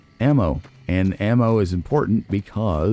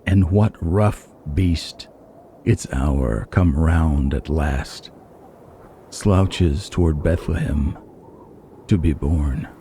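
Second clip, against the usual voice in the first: slow, solemn poetry reading with long pauses; poem recitation; slower than usual. Acted. Gamer opening the video by reciting Yeats, slow and solemn. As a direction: intone each line slowly, like a prophecy.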